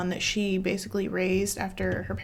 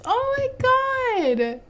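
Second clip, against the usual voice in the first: high, slow, stretched out gush; 3 dB louder, much slower than usual. Real, not acted. Gamer overwhelmed by nostalgia for an old room, gushing. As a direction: let it pour out, overwhelmed.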